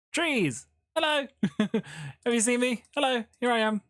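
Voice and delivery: high sing-song hello; playful calling. Pretend. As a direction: call out playfully from hiding. Hiding in game, calling playfully.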